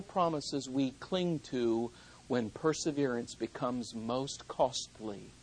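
very soft, low, slow promises; tender hush. Acted. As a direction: whisper the promise like a comfort at a bedside. Soft quoted promises of God.

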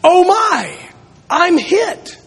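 high-pitched hammy stage cry; dramatic shout. Acted. Preacher acts out a boy's school-play line, mock dramatic cry. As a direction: play a villain's dying line, high and theatrical. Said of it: "he was to shout out".

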